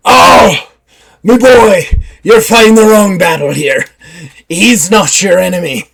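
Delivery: put-on old pirate voice. Simon Grimes stopping a fight, in a loud pirate voice. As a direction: scold him like a salty old sea dog. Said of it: "stepping in front of you and goes".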